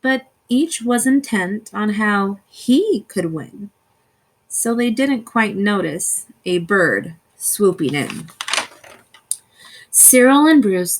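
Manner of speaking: ordinary delivery, nothing marked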